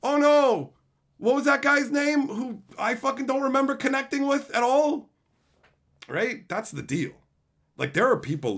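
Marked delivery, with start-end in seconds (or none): 0.0-5.1 lightly raised mock worry; a hint of fake concern; mock dismay. Streamer imitating fake regret over a lost viewer, mild mock dismay. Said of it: "I'm not gonna be like".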